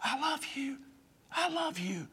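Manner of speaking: soft high whisper in the ear. Acted. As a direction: breathe it softly into the ear. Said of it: "He whispers in your ear".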